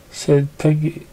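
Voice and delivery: ordinary delivery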